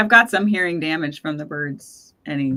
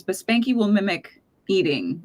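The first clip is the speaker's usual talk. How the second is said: usual speaking voice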